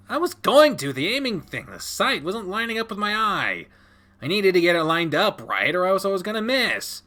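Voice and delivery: high-pitched, whiny self-defence; defensive child. Acted. Child explaining missed shot to dad, defensive whine. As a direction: make excuses in a child's high voice.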